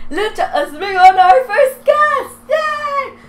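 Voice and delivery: high, loud excited shout to welcome the dog; gleeful squeal of welcome; excited cheer. Real, not acted. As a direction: cheer the first guest in like a game show host. Introducing first pet excitedly.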